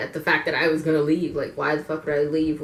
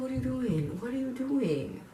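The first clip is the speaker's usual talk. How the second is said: "What are you doing?" said higher but softer, almost to herself; a soft, stunned higher-pitched question; incredulous murmur; 8 semitones higher, 7 dB quieter than usual. Shown as a demonstration. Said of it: "It didn't make it".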